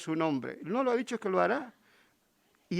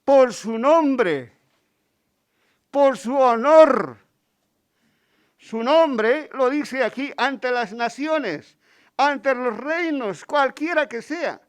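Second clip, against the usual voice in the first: slow, loud and raised, every phrase weighted; emphatic preaching; 4 semitones higher, 8 dB louder, slower than usual. Acted. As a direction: drive home God's name and honour. Predicación enfática. (predicación lenta y enfática, más fuerte)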